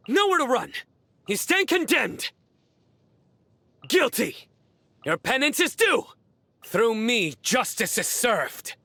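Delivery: high, forceful condemning war cries; battle cries. Acted. Warrior passing judgment on his foes, high forceful battle cries. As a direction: pronounce judgment on the enemy with each cry.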